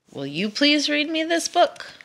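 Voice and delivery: high, pleading request; hopeful asking. Acted. Dragon pleading with the bull, high and hopeful. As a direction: lift it up, hoping for a yes.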